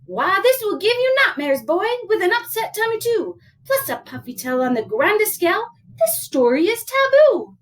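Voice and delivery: put-on high storybook mother lecturing her boy; disapproving mama. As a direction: warn a child off it dramatically.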